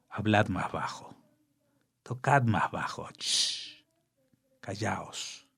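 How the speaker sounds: dropping to a hush, fading to a whisper. Acted. Poem line asks for silence. (pidiendo silencio, cada vez más bajo)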